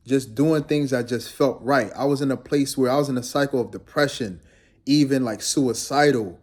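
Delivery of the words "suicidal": ordinary delivery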